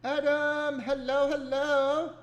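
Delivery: loud, high, happy hello; excited greeting. Real, not acted. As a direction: call out a big cheerful hello. Greets arriving friend excitedly.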